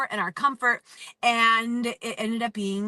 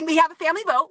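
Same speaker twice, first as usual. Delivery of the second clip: louder, higher, bursting out excitedly; 6 semitones higher, 6 dB louder, faster than usual. Real, not acted. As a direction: blurt it out, a little giddy. Laughing, stammering into the announcement.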